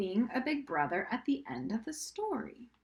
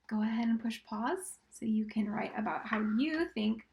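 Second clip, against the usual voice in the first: whisper. Acted. Teacher whispers writing directions to the students. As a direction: whisper the instructions gently.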